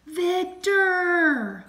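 loud, high, drawn-out call; very loud yell. A narrator's reading. Vinnie searching for his lost friend Victor, very loud yell. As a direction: yell the name out long and high. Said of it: "the volume was very loud".

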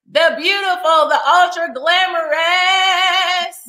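high, slow, drawn-out announcer build; grand guest introduction. Pretend. Host bringing on artist Cheryl, grand introduction. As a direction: stretch the praise out like a show announcer.

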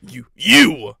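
loud, stuttering outburst; stammer, then hit it hard; stammered accusation. A narrator's reading. Stephen rounding on the owl, loud stammered accusation. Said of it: "stammered Stephen".

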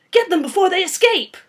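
high, loud battle cry; urgent shout. Acted. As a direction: shout an order in mid-fight. Calling out during the fight.

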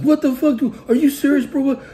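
high-pitched yell of mock panic, acting out his freak-out. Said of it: "He's fucking freaking out".

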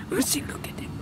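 secret whisper; sneaky whisper. Acted. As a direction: hush it so he won't hear. Sneaking a look.

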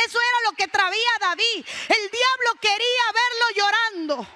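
loud, driving preacher delivery, high and unrelenting; full-force preaching. Acted. Preacher tells congregation the devil wanted him weeping, full-force preaching. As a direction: build it phrase on phrase like a revival preacher.

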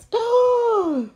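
sharp loud gasp, pitched high; loud frightened gasp. Acted. Mowers frightened by the cat.

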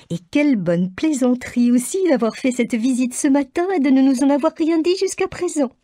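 high, gushing delight; mother gushes gratitude to her husband; overjoyed mother. A narrator's reading. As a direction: gush with relief and joy.

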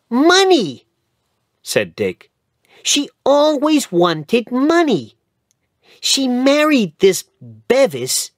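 put-on high, loud indignant boy's voice; indignant boy Dick. A narrator's reading. Dick tells Mister Hobbs about Minna, high indignant voice. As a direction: spit out the word money in disgust.

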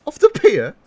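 high, loud disbelieving question; shocked cry. A narrator's reading. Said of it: "Ben cried".

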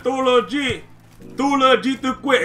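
"Too legit" with a light Spanish accent, "legit" said as "lo-hee"; playful mock Spanish take on "Too legit"; Spanish version. Shown as a demonstration. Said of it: "the Spanish version".

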